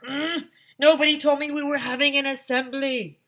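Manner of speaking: high, loud grumbling complaint; grumble, put out; irritated teacher voice; annoyed teacher. Acted.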